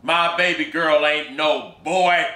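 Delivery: a stunned father demanding the truth; angry father. Acted.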